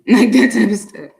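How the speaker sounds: laughing. Real, not acted. Laughs while saying it.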